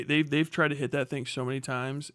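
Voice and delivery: ordinary talk, nothing marked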